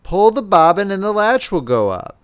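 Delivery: put-on the wolf faking an old woman's voice; wolf pretending to be grandmother to trick the girl; disguised wolf voice. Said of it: "disguising his voice as much as he could".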